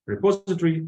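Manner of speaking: "Repository" as re-POS-itory, high and loud; stress on second syllable. Shown as a demonstration. Teacher sets full word beside short, "Repository" stressed re-POS-itory. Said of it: "the stress of the second syllable".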